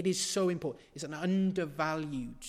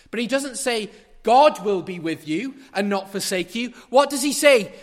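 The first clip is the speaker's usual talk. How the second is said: rising, louder preacher's build; fervent preaching; 4 semitones higher, 10 dB louder than usual. Acted. Preacher to his congregation, building a fervent louder sermon. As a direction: drive the point home from the pulpit.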